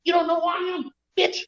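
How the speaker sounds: hammed-up diva snap at the lady. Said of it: "He said".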